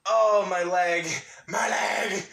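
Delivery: loud, drawn-out moan rising to a yelp; in pain. Real, not acted. Freeing a pinned leg, pained wail. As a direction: wail over a sore leg, playfully. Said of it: "had my leg pinned underneath my butt".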